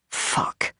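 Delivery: breathy, unvoiced curse; whispered curse. A narrator's reading. Captive cursing to himself in a whisper. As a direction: breathe it under your breath.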